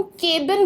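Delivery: केबिन with a long "kay" vowel; wrong pronunciation. Shown as a demonstration. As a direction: stress the a in केबिन as "KAY-bin". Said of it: "ए पे बहुत ज़्यादा stress". (केबिन का गलत उच्चारण, "के" पर ज़ोर)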